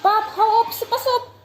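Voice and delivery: yelling. Real, not acted. Kid yelling at dad.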